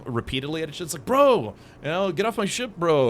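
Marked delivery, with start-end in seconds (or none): 1.0-3.1 loud, higher mock shouting match; mock shouting bro. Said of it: "they were just shouting "bro".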